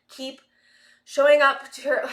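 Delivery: short of breath, pushing the words out; breathless, gasping between words. Real, not acted. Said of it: "I'm out of breath".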